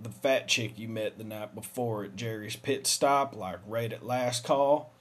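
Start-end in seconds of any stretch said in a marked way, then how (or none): none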